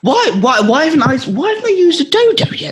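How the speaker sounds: excited. Real, not acted. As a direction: pitch it up in disbelief. Indignant about missing dodo joke.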